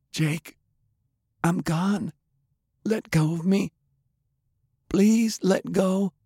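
high-pitched woman's voice, sorrowful; dying woman, pleading. A narrator's reading. Undead Delilah asking Jake to let go, pleading. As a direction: plead gently in a high female register.

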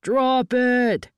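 high shouted command; forceful standoff order; yelling back. A narrator's reading. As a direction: yell it back at the other side. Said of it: "the others yelled back".

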